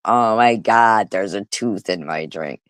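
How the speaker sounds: normal talking voice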